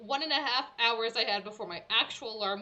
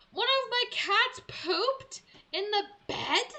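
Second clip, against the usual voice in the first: high, loud, slow horrified question; high, slow appalled question about the bed; appalled disbelief; 11 semitones higher, 5 dB louder, slower than usual. Retold or imitated. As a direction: drag it out in horror. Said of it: "And I was like".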